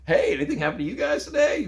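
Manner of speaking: high, laughing smug question; smug teasing. Retold or imitated. Boy teases his defeated dad at dinner, high and laughing. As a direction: needle the family, grinning.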